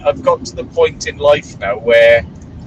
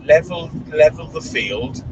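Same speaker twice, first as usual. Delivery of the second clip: mock plea; 4 semitones higher, slower than usual. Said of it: "Something like".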